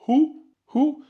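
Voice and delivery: "Hou" light and high, said calmly. Shown as a demonstration. Teacher shows the little light h, calm and light. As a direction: say "Hou" gently, easy breath.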